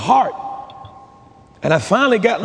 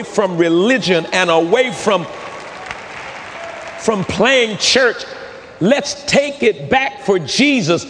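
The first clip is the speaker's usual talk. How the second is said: high, drawn-out rallying cry from the pulpit; rallying preaching; 7 semitones higher than usual. Real, not acted. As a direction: urge the congregation on, pitched high, stretching the key words.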